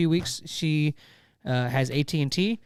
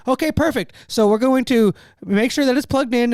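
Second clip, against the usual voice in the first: higher, bright scripted helpdesk reply; parroting the agent's script, higher; mock support agent; 6 semitones higher, 3 dB louder than usual. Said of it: "'cause he's reading the script".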